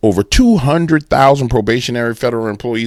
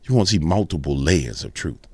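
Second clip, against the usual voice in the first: ordinary talk, nothing marked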